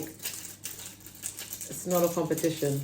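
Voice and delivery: soft, slow, breathy-free murmur of denial; mock calm denial. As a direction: say it softly and slowly, unbothered. Client shrugs off rivals, quoted.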